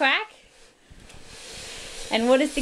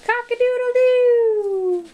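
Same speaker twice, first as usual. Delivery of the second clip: high, slow, louder rooster call; playful high cock-a-doodle-doo for a toddler; rooster crow; 13 semitones higher, 4 dB louder, slower than usual. Pretend. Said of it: "What does the rooster say?".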